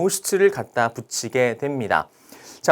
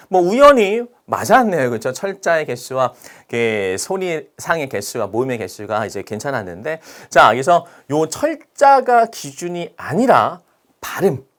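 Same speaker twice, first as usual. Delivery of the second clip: normal talking voice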